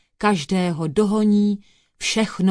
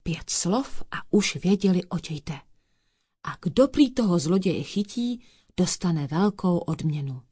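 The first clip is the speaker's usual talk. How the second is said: usual speaking voice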